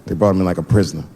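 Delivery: ordinary talk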